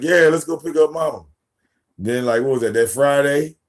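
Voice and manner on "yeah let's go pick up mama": higher, imitating a cheerful kid; lightly voiced daughter; eager daughter. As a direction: chirp it like a happy child. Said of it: "she was like".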